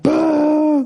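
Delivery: high hammed-up boo; imitating a booing crowd; mock crowd boo. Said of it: "Bama fans were like".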